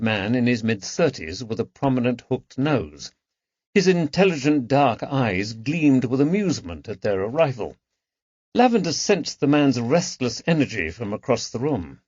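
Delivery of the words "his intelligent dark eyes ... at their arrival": ordinary delivery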